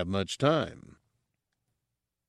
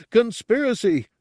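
proud exclamation; 13 semitones higher, 6 dB louder than usual. Acted. Gartog's proud announcement.

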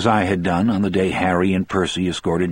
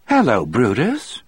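high, cheery woman's voice; cheerful; 5 semitones higher than usual. A narrator's reading. Melinda greets Brutus as if just noticing him, cheerful. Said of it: "said in a cheerful voice".

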